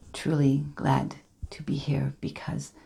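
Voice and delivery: hushed, heartfelt whisper on truly; mean every word, softly; sincere. Acted. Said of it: "But when I say it today, I mean it".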